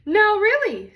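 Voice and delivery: a fussy mother bear scolding; prim Mama Bear. Acted. Mama Bear to her family, scolding the fuss, high and loud.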